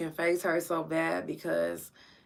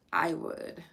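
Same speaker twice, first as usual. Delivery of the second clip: normal talking voice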